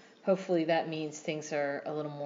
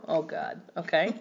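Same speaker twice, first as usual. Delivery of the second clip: normal talking voice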